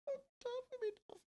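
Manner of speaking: mock wail. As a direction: fake a tiny sad cry, way up high. Mock self-pity about dying.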